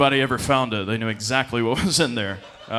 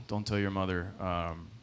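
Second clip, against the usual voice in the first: dad's line quietly; 4 semitones lower, 8 dB quieter than usual. Retold or imitated. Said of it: "my first lesson in marriage".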